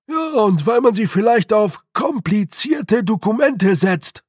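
loud, high character voice; high-pitched clansman in a fluster; alarmed little man. A narrator's reading. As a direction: wail about the dreaded paperwork. Alarmed clansmen list feared documents.

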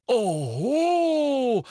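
loud high scoffing oho; mocking oho. A narrator's reading. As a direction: scoff loudly. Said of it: "machte Fudge".

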